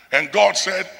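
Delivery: loud, ringing pulpit warning; full-voiced sermon delivery; preaching at full force. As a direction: thunder it at the congregation like a preacher. Preaching on Israel's ingratitude.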